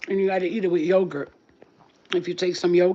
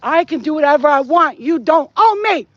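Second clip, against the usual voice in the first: defiant shout; 8 semitones higher, 10 dB louder than usual. Shown as a demonstration. Spiritual vlogger models standing up to dark entities, shouting defiantly. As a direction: shout it back at them, refusing to be owned. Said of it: "You have to be like".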